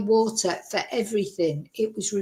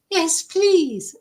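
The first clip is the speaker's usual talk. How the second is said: higher, still keen but calmer second take; eager yes please; 7 semitones higher, 3 dB louder than usual. Retold or imitated. Thirsty guest repeats her thanks to the neighbour, calmer but keen.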